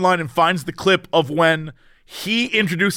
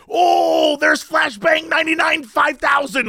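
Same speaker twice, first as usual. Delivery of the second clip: excited shout; 9 semitones higher than usual. Retold or imitated. Coworker spotting another streamer, high starstruck shout. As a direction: yell out the streamer's name like a fan.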